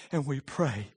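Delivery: ordinary delivery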